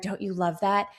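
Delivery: ordinary talk, nothing marked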